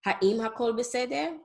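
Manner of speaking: "Ha'im hakol beseder" asked, rising at the end; question intonation. Shown as a demonstration. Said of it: "It's just for a question".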